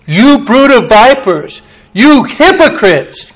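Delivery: slow, rising, emphatic condemnation; stern rebuke. Acted. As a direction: drag out each word as an accusation. Jesus rebukes the Pharisees.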